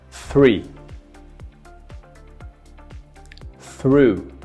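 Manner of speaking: each word slow and clearly separated; slow exaggerated speech modeling. Shown as a demonstration. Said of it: "I want you to imitate me".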